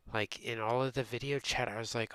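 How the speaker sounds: normal talking voice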